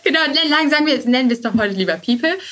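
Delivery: high, quick amused teasing. Real, not acted. Guest teasing host about renaming HR to People, amused. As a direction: tease the host playfully.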